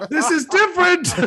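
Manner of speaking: high, loud yapping voice breaking into laughter; translated dog bark. Retold or imitated. Host voices his littlest dog's bark, yapping indignantly. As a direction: protest like an outraged little dog. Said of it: "her bark, in, if you could translate".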